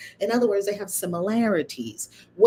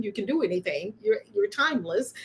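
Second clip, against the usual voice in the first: ordinary talk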